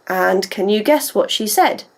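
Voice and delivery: usual speaking voice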